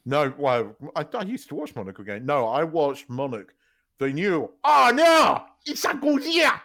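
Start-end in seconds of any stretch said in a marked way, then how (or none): 4.6-6.5 high, loud mock-terrified yell; exaggerated alarm, played for laughs; hammed-up panic. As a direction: cry out like a monster-movie victim. Mimics a monster-film panic cry.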